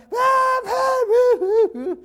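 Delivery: put-on loud, high-pitched child's wailing; mock tantrum wail. Kid refused something in the store, mock tantrum wailing. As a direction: wail like a kid throwing a fit. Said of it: "some kid throwing an absolute fit".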